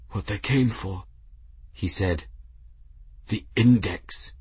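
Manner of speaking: lightly voiced, pained dying man; weakened monk giving last words. A narrator's reading. Dying monk coughing in pain.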